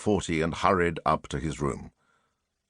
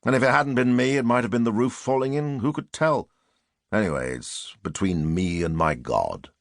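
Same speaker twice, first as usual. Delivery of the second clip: plain reading voice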